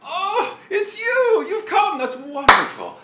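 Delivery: high, loud gushing welcome; delighted little figure. Acted. Little figure greets an arriving friend, high excited delight. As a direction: squeal the greeting, overjoyed. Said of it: "a little form just sort of sweeps up".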